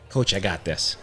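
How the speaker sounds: quietly reassure, playing the player; imitated low confiding. Player confides in coach, retold.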